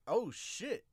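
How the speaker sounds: ordinary talk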